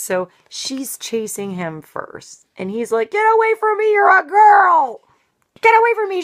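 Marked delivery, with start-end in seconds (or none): 3.1-6.2 put-on high, loud childish yell; a little boy shrieking to shoo her off; boy shouting. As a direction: panicky kid shout. Boy fleeing girl as kids.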